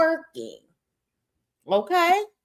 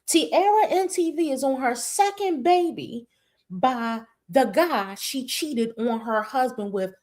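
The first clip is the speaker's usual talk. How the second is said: ordinary delivery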